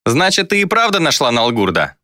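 put-on cocky young sorcerer; smirking. A narrator's reading. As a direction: ask it with a lazy smirk. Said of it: "ухмыльнулся молодой колдун".

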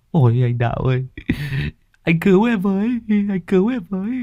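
desperate pleading. A narrator's reading. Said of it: "túm lấy anh Đạo mà van".

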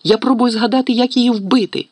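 high, exasperated shout; frantic cry. A narrator's reading. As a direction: cry out to silence him. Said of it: "крикнула Герміона".

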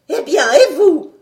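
loud, high challenge; sharp demanding shout. A narrator's reading. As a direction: turn on the idle companion, shouting. Doctor rounds on idle narrator.

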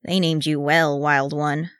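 usual speaking voice

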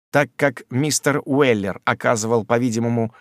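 ordinary delivery, nothing marked

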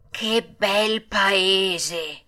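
very slow, dreamy exclamation at normal volume; overjoyed, drooling delight. A narrator's reading. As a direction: linger blissfully on every word. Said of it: "sputando dalla soverchia consolazione".

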